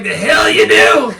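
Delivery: angry retort. Retold or imitated. Landowner snapping back at Christina. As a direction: snap back in disbelief. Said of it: "The guy's like".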